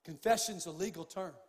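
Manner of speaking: ordinary talk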